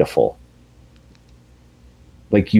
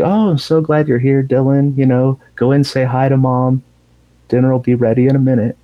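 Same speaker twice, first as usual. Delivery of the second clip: higher, lightly mock-cheerful host; breezy, imitated obliviousness; as if nothing's wrong; 6 semitones higher than usual. Norman welcomes his brother to dinner, mock-cheerful. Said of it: "like, nothing's wrong".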